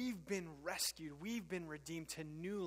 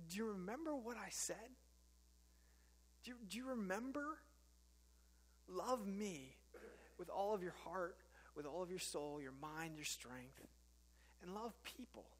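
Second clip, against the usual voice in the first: tender God; 7 dB quieter than usual. Retold or imitated. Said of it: "But he says".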